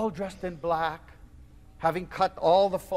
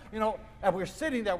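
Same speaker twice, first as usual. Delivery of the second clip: usual speaking voice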